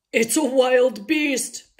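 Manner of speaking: slow, loud growl. A narrator's reading. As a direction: growl it like a wary papa bear. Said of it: "Papa Bear growled".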